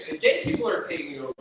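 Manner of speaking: usual speaking voice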